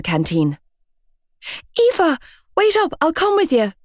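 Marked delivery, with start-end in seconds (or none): 1.8-3.7 much higher voice calling out; friend calling after. A narrator's reading. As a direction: call after her from behind. Friend calls after her.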